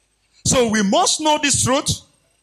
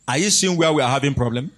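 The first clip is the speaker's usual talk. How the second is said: ordinary delivery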